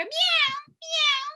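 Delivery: very high, loud "meow"; real cat meow. Shown as a demonstration. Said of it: "They go".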